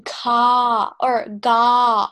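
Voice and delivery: ka or ga drawn out slowly and firmly; stretched teaching voice; emphasized syllables. Shown as a demonstration. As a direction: lean hard into ka or ga, very slowly. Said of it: "you really want to emphasize the".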